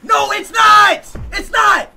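high, very loud, drawn-out denial; real screaming. Real, not acted. Denying to a player that he is Speed, screaming. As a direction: scream the denial, stretching each word. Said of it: "Why are you screaming like that?".

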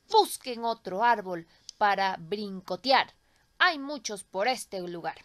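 loud, stern tree voice; scolding old tree. Acted. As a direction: scold the birds away from your branches. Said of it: "dijo el árbol a los pajaritos".

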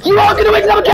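very high, loud shriek; screamed taunt. Real, not acted. Gamer warning a rival they'll lose, screamed taunt. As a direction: scream it as a furious boast. Screams at opponent during play.

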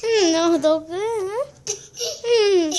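giggling through words; laughing. Real, not acted. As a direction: laugh while talking. Laughing fit during play.

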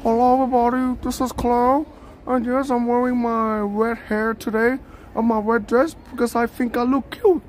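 high-pitched put-on woman's voice; high, mock-cute streamer impression; Cleo. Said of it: "I found a redhead Cleo".

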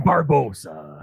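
lightly voiced low pirate captain; a low-pitched hint of pirate villain; Barbossa. Cast member asked for a pirate, low mock-villain impression. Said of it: "Can you do a Barbossa".

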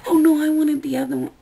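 lightly mocking a picky eater; mock fussy customer.

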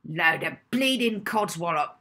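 put-on a loud, gruff old cook's bark; loud gruff navy cook dismissing the child. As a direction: bark it like a grumpy pirate cook. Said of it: "Edna's gruff reply".

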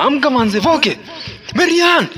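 high, very loud desperate shouting; panicked shout. Acted. Friend screaming for an ambulance over his body, frantic loud shout.

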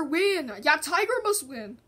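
ordinary talk

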